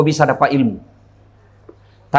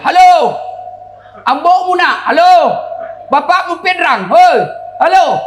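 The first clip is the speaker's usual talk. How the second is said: high, loud shouted hellos; shouting into phone; 12 semitones higher, 3 dB louder than usual. Retold or imitated. Rich villager yelling hello into a wartel phone. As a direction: yell down the phone line.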